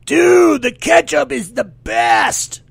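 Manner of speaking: mock hoser shout, high and drawn out; heckling hoser. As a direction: loudly imitate a hoser giving you grief. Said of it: "hosers from all over Canada giving me shit".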